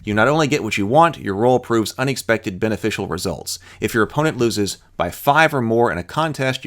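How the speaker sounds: ordinary talk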